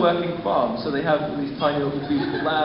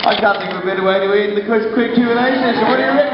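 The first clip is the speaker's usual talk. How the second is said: announcer; 6 semitones higher, 7 dB louder than usual. Acted. Host wrapping up loudly.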